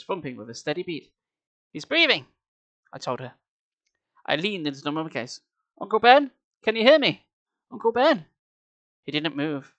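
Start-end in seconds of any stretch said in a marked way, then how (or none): 1.7-2.3 high, lifted announcement; excited discovery. A narrator's reading. Gabe tells Sari about Uncle Ben, excitedly. 5.8-8.3 loud, high attempts to rouse someone; urgent calling. A narrator's reading. Gabe leans over his unconscious uncle, calling urgently.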